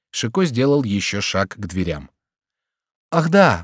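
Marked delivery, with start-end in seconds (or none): 3.1-3.6 higher-pitched cry of sudden recollection; officer's abrupt call; sudden exclamation. A narrator's reading. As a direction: exclaim as if just remembering. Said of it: "вскричал офицер".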